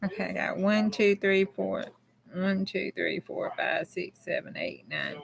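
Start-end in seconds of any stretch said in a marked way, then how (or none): 2.3-5.2 counting in a breathy whisper under her breath. Real, not acted. Streamer counting names to herself in a whisper. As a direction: murmur the numbers to yourself.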